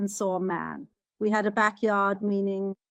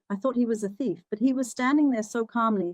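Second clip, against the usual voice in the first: ordinary talk, nothing marked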